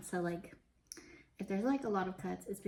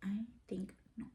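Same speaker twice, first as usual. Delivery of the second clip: whispering; 5 dB quieter than usual. Real, not acted. Whispered aside.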